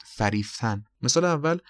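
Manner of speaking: ordinary talk, nothing marked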